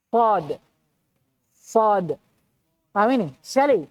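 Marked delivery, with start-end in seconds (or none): 0.1-2.2 sad with the tongue back raised, heavy and thick. Shown as a demonstration. Teacher demonstrating a thick sad. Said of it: "Bunyinya menebal".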